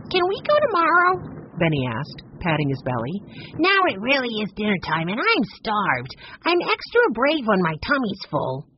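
put-on high, bright child voice; eager little boy. A narrator's reading. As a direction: sound like a hungry little kid. Benny eager and hungry.

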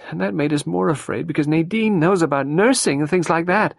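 put-on Carol, young and overwrought; distressed young woman. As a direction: pour it out, near tears. Carol confesses her fears.

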